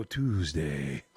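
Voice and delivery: very low, drawn-out mock boom; comic deep-voiced punchline; put-on Tuesday voice. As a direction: drop way down and stretch the word. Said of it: "I even did the voice".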